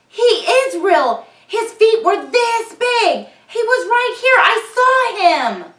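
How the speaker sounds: high, loud, slow insistence; desperate protest. Acted. Ben protesting to the doubting town, high and loud. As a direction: beg them to believe you.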